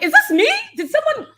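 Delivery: high, incredulous disbelief; disbelieving. Retold or imitated. Reacting in disbelief.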